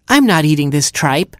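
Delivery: high little Hamster voice, defiant; brave defiance. A narrator's reading. Hamster refuses Skunk's dinner, brave. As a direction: stand up to her in a small voice. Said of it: "said Hamster bravely".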